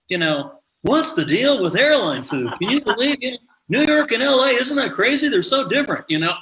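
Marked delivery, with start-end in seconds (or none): 0.8-6.0 high, sing-song observational comic voice; mock Seinfeld bit, pitched up and bouncy; Seinfeld impression. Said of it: "everybody was trying to do that sort of".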